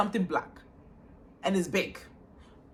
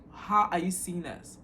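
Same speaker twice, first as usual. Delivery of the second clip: slow, deliberate repeat of someone's words; quoting back pointedly. Retold or imitated. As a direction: spell out their slip, slowly. Quotes her words back to her.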